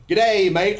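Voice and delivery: high, loud mock Australian greeting; bad Australian accent. Local greeting a visitor, badly faked Australian accent. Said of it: "I can't do their accent".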